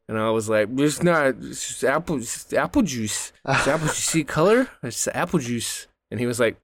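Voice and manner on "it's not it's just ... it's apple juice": put-on drunk slurring. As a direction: play it tipsy and stubborn. Drunk guy insisting to worker.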